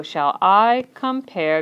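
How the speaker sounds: "shall I compare" slow, com-pare split in two; measured beats, each syllable held apart. Shown as a demonstration. Teacher marks syllable breaks for students, slowly separating each beat. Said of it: "there's a line down the middle".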